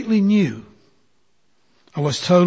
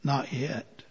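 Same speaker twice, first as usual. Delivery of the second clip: much softer, low and calm; kind; 3 semitones lower, 12 dB quieter than usual. Acted. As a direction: answer with a kind smile. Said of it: "kindly smile and say".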